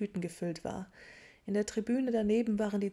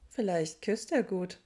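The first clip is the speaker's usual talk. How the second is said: teasing wink; 4 dB louder than usual. A narrator's reading. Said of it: "zwinkerte Hermine zu".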